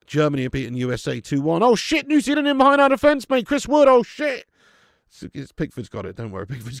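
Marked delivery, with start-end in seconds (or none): 1.6-4.5 high, loud panicked cry at the danger; real panic over a goal chance; alarmed shout. Real, not acted. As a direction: yell as the striker breaks through. Rival attack on England's goal.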